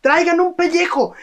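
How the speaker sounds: fretting villager. Acted. Villager insists on waterskin.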